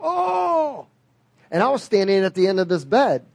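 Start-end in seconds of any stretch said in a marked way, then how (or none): 0.0-0.9 sudden high gasp of pain; a sharp, high startled "oh". Retold or imitated. Dying woman in hospital bed, high pained gasp. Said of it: "the woman in the hospital bed would go".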